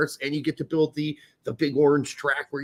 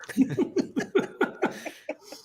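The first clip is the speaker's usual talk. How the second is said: unvoiced wheezy laugh; 10 semitones higher, 5 dB quieter than usual. Real, not acted. Laughing after the impression.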